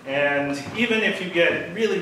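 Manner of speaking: ordinary talk, nothing marked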